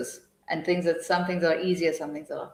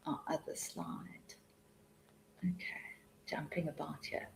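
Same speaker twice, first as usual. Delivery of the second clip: whispered aside; 21 dB quieter than usual. Real, not acted. Changing slides.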